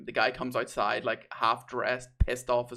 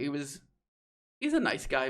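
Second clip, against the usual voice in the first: ordinary talk, nothing marked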